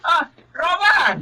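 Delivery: high call across the yard. Acted. Aunt greeting her nephew, calling out. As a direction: call out his name gladly.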